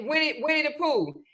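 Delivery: ordinary talk, nothing marked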